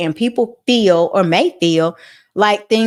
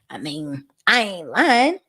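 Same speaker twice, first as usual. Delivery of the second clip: usual speaking voice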